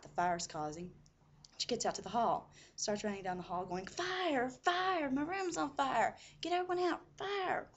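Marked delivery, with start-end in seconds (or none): 3.9-7.7 high-pitched mock scream of fire; retold screaming alarm. Mom running down the hall to warn everyone, screaming.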